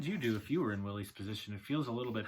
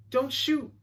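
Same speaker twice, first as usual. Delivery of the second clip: put-on high, loud boy's plea; urgent yell; 12 dB louder than usual. A narrator's reading. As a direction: yell it high to stop a gunman. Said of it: "Little Willie yelled".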